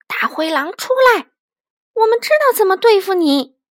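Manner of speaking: loud, high challenge to an enemy. A narrator's reading. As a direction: call the wolf out at full voice. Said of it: "大声说". (大声叫阵)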